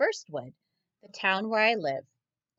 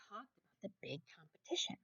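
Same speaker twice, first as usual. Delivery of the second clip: breathy unvoiced whisper, much softer; 14 dB quieter than usual. A narrator's reading. Narrator whispering to the children about the competition. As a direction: drop to a secret whisper.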